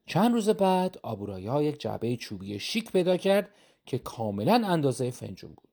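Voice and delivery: ordinary delivery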